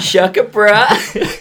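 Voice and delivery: high, drawn-out loud cartoon call; South Park zip line. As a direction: holler it slowly with a laugh. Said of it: "the South Park episode with the zip line".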